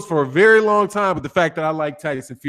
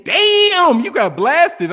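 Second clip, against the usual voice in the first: surprised outburst; 8 semitones higher, 3 dB louder, much slower than usual. Real, not acted. Viewer suddenly timed out in chat.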